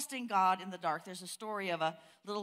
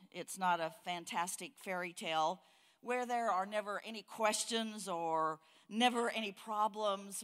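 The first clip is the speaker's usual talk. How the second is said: normal talking voice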